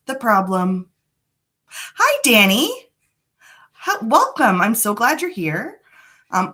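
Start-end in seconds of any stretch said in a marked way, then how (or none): 1.7-4.6 bright, much higher hello to a viewer; excited greeting. Real, not acted. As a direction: greet them like a friend just walked in. Greeting a live chat viewer.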